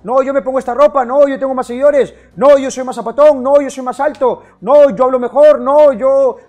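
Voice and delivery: loud mocking imitation of show-offs; mock bragging men. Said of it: "los hombres están preocupados por qué carro manejo".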